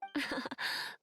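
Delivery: short amused laugh under the breath. Acted. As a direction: laugh lightly at the naive question. Amused at Paimon's question.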